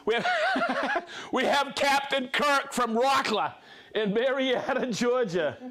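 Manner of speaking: cracking up mid-name, pitch jumping high; laughing. Real, not acted. Host laughing while reading out a viewer's joke name. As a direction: laugh your way through the name.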